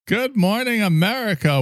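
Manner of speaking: high, sing-song morning show greeting; hammed-up breakfast TV host; mock TV host. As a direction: chirp it like a cheesy morning host.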